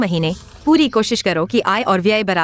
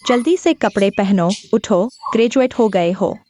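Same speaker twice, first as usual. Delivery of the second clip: slow, insistent wake-up call; moderately raised, drawn-out nagging; raised urging voice; slower than usual. Acted. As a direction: urge them up, stretching out the words. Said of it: "भविष्य में धीरे बोलो".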